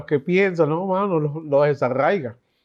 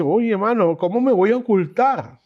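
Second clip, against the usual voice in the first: frightened plea; 6 semitones higher than usual. Retold or imitated. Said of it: "Le tenía miedo".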